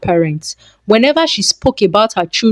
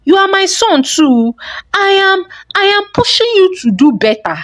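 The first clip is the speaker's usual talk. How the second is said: much higher, indignant protest; defensive mother; 11 semitones higher than usual. Acted. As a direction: defend yourself sharply against the accusation. Frowning mother defends herself.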